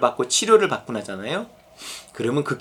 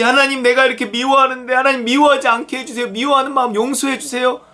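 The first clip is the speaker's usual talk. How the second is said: higher-pitched mock pious plea; acted pleading prayer; 8 semitones higher than usual. Preacher acts out a believer asking God's forgiveness, pleading. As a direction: plead in a raised, steady praying voice.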